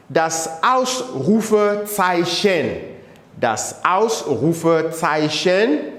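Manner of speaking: Ausrufezeichen drawn out slowly, syllable by syllable; slow model pronunciation. Shown as a demonstration. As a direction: drill it slowly for the class. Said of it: "pronounce them again".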